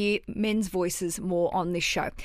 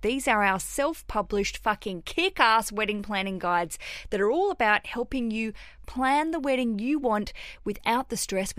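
normal talking voice

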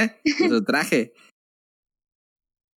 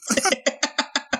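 breathy laugh; 12 semitones higher, 4 dB quieter than usual. Real, not acted. Laughs at own mistake.

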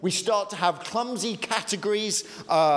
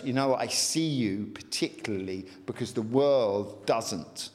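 tender Lord; 7 semitones lower than usual. Retold or imitated. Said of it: "He goes".

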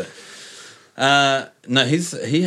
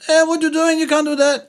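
high, loud startled protest at a stranger; indignant shout; 4 dB louder than usual. Retold or imitated. Friend yells at the drunk peeing on his car, high indignant shout. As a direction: call out the man peeing on your car, louder and higher.

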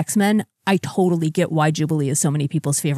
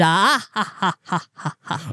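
Count laugh. Pretend. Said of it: "it inevitably turns into the Count".

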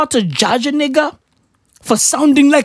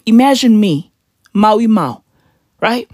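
usual speaking voice

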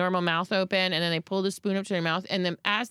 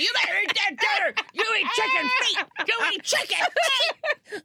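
higher scolding shout, slowing on the end; mock shouting mom; 12 semitones higher than usual. Mom scolds son about dinner, higher mock shout. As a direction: scold the kid up high. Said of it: "shouting at you, being like".

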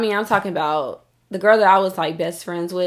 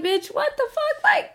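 incredulous. Retold or imitated. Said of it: "I'm like".

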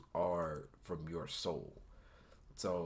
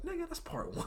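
high-pitched laughing retort. Retold or imitated. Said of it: "I was like".

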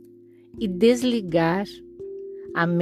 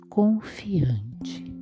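soft spa whisper; 5 dB quieter than usual. Acted. Meditation cue.

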